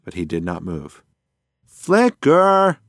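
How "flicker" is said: loud, high yell to wake him; sharp wake-up yell, much higher and louder. Acted. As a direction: shout his name to rouse a sleeper. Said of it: "she yelled".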